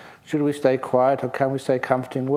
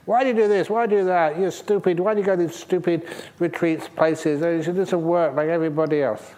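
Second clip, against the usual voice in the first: higher, louder scolding husband, hammed up; mock angry shout; 4 semitones higher, 3 dB louder than usual. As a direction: bark the questions like a fed-up husband. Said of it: "he shouts at you".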